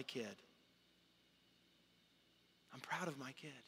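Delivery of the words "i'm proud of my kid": put-on much softer, tender repeat of proud of my kid; softer echo. God repeating his pride, softly to himself. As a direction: let it fall to a soft, near-private echo. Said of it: "God declared it in front of others".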